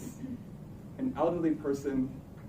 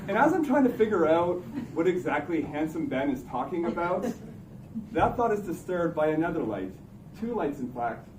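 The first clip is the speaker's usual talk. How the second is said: ordinary delivery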